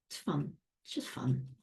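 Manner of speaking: breathy whisper, almost to herself; whispered aside. Real, not acted. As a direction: whisper it like a little secret. Whispered aside about fun.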